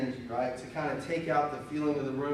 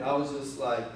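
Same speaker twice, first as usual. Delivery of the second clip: ordinary delivery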